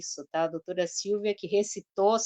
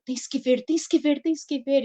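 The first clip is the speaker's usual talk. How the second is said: a slightly raised, eager mock urging; imitating excited friend; 6 semitones higher than usual. Host imitating Silvia urging her to watch, mildly excited. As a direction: retell her breathless insistence, lightly. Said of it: "não expressei com toda a entonação de voz dela".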